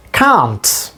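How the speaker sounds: "can't" with a long broad ah vowel; British English "can't", open long ah; British pronunciation. Shown as a demonstration. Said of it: "In British pronunciation".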